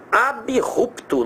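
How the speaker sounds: abrupto said slowly as a-bi-rup-to; odd variant spelling. Shown as a demonstration. Teacher showing the strange abrupto variant. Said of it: "essa daí bem estranha".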